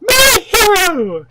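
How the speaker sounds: high slow shouted my hero; swooning damsel. Pretend. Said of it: "poor woman in distress".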